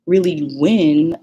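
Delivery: ordinary talk, nothing marked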